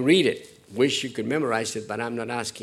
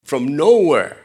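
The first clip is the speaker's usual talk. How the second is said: louder, punched out; loud preaching; 13 dB louder, slower than usual. Acted. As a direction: hit it like a warning from the pulpit. Preacher emphasis.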